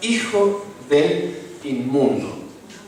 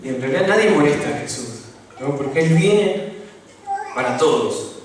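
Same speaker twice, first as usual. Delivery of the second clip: usual speaking voice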